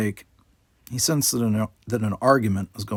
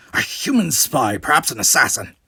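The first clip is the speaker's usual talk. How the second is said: loud rasping accusation; harsh rasping Cardassian; 3 semitones higher, 6 dB louder than usual. Acted. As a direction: bark it out as a harsh alien soldier. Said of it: "rasped a harsh Cardassian voice".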